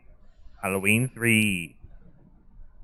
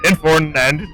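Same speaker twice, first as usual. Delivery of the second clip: radio dispatcher; 4 semitones higher, 6 dB louder than usual. Acted.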